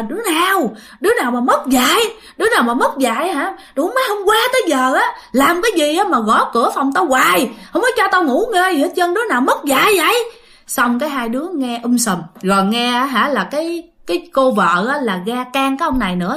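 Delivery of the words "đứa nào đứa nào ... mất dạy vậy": imitated higher-pitched scolding rant; angry cursing neighbor. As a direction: act out an irate man cursing noisy kids. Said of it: "là ổng chửi thề nha".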